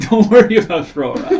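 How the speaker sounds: laughing through the words. Real, not acted. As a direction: say it while cracking up. Laughing at the joke.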